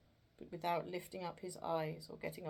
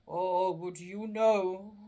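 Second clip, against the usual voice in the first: slow, loud, mournful spirit voice; a chained ghost lamenting its fate; doleful ghost; 9 dB louder, slower than usual. Acted. Marley's ghost lamenting to Scrooge, slow and doleful.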